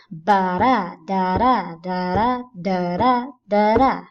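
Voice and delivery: loud, even ba-da da-da beat in five pairs; iambic rhythm. Shown as a demonstration. Teacher demonstrating how the line will read, rhythmic nonsense syllables. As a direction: beat out each da-da as a steady two-syllable pulse. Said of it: "each line has a bit of a rhythm".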